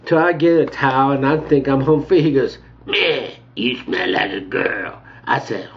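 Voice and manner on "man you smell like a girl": slow put-on old man's voice; old guy. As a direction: draw it out like an old attendant. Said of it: "The old guy".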